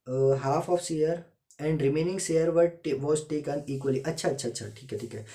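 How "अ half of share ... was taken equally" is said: reading the question aloud word by word, slow and louder; slow, deliberate classroom dictation. Shown as a demonstration. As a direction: dictate it slowly so students can write. Reads question slowly, dictation style.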